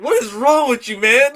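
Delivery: mock outburst at someone, tickled by it; exasperated movie quote. Co-host quoting a hormone-pilled wrestler, mock exasperated. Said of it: "He's like".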